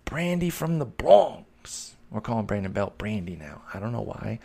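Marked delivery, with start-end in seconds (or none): 0.0-2.0 put-on high, slow, drawn-out New York Bronx accent; slow mock Bronx accent. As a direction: stretch it out like a Bronx local. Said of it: "why I'm giving that sort of accent".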